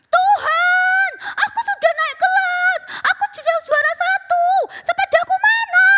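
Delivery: put-on very high, loud child's yelling prayer; shouted prayer. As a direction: scream it to the sky. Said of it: "Dikencengin lagi sama dia". (anak berteriak berdoa sekeras-kerasnya)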